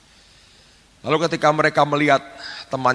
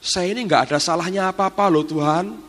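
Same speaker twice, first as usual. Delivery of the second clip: higher-pitched, pleading protest of innocence; imitating frightened servant; 4 semitones higher than usual. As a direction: plead your case to God like a scared maid. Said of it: "Dia ngomong gini".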